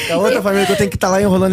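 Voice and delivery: ordinary delivery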